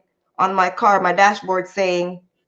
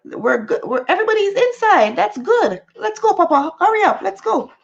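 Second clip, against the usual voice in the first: hurried cheer; 9 semitones higher than usual. Retold or imitated. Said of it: "I'm like".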